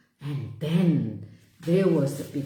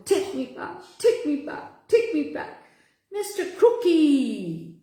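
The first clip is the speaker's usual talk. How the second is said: higher, squealing little pig; a pig pleading to be taken home; funny piggy voice; 6 semitones higher than usual. A narrator's reading. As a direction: squeak it out like a cheeky piggy bank. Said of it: "spoke in a funny piggy voice".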